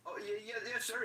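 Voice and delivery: high, eager submissive yeses; meek yes-sir. Retold or imitated. Coworker imitating meek Corey answering the boss, high and timid. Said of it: "And like".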